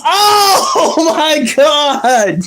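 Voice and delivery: slow, drawn-out laugh-filled gasp; laughing shock. Retold or imitated. Brother sees the forehead slit, laughing. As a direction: stretch it while cracking up. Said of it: "And he goes".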